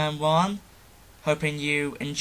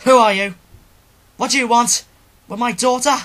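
much higher, much louder angry demand; Jubei confronting intruders; 8 semitones higher, 11 dB louder, faster than usual. Acted. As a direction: shout down the strangers threatening his daughter. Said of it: "for the role of Jubei".

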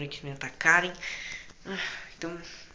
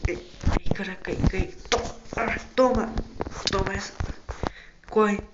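loud, high frantic shouting; panicking gamer; 4 semitones higher, 3 dB louder than usual. Real, not acted. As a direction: yell each hit in a frenzy. Fighting animatronic, shouting hits. (gamer em pânico gritando)

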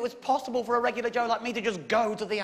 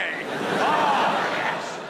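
gleeful; 4 dB louder than usual. Real, not acted. Gloating over the new find.

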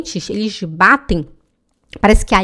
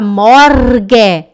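Swiss German "morge", loud rolled r and open e; 6 dB louder than usual. Acted. Student asks whether they may say it, Swiss German accent. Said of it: "Já que eu moro aqui na Alemanha".

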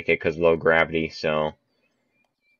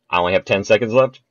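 rushed; 3 semitones higher, 5 dB louder, faster than usual. Real, not acted. Timer running out.

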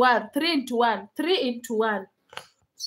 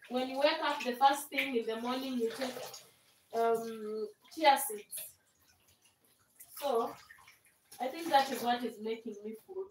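soft, sated talk; 11 dB quieter than usual. Real, not acted. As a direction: talk softly after a big meal. Eating and feeling full.

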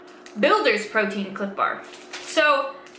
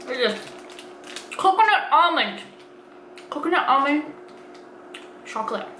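talking with mouth partly full; eating snack bar while talking; chewing food; 3 dB quieter than usual. Real, not acted. Seen 2: Bites bar at -5; jaw and lips keep chewing through the words.

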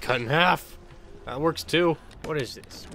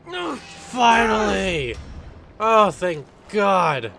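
slow, loud shout of relief; relieved celebratory yell; 7 semitones higher, 6 dB louder, much slower than usual. Real, not acted. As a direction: let it all out, slowly. Relief after long wait.

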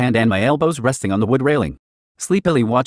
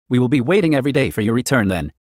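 plain reading voice

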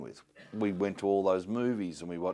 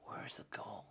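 hushed, breathy storyteller secret; conspiratorial whisper about treasure; mock mystical whisper; 2 semitones higher, 15 dB quieter than usual. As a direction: whisper it like a fairy tale.

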